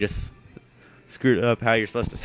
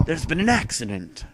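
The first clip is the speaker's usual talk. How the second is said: voicing the professor; 7 semitones higher than usual. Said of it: "he's like".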